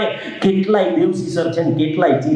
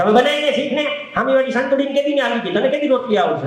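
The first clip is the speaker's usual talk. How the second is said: higher, quick mock nag; nagging mother; 9 semitones higher, faster than usual. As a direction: imitate mom's taunting comparison. Mother taunting daughter. (મમ્મીના ટોણાનો ઊંચો, ઝડપી નકલી અવાજ)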